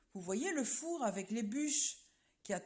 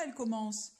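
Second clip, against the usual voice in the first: ordinary delivery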